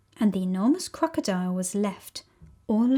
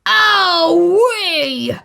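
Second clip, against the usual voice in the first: high, loud yelp of pain; pained cry; 8 semitones higher, 7 dB louder than usual. Acted. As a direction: yelp as you get knocked flying. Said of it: "cried the enormous crocodile".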